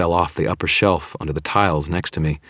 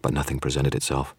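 usual speaking voice

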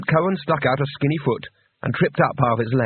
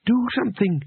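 urgent nudge; 8 semitones higher than usual. A narrator's reading. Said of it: "Bethan elbowed Two Flower".

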